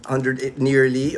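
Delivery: usual speaking voice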